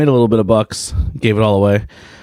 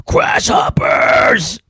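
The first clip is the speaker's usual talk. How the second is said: slow, higher shouted grasshoppers; drawn-out kid shout; 9 semitones higher, much slower than usual. Retold or imitated. Kid answering his mom, drawn-out shout. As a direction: stretch out grasshoppers like a cheeky kid. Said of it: "he goes".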